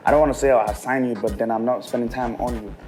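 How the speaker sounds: ordinary talk, nothing marked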